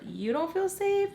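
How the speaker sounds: scornful high-pitched challenge; mock disbelief. Repeats her scornful thought.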